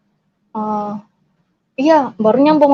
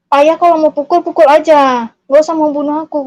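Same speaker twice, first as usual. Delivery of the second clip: higher, louder challenge; daring him, heated; re-enacted defiance; 4 semitones higher, 5 dB louder than usual. Child defies father in quarrel.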